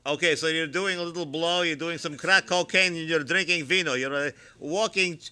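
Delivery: normal talking voice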